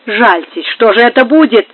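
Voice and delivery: plain reading voice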